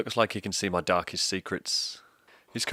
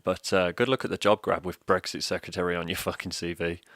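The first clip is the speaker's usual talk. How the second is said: ordinary talk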